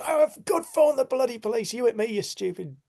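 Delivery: high, loud sarcastic tirade; mock furious shout. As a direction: rant high and loud, easing off at the end. Re-enacts livid shouting at driver.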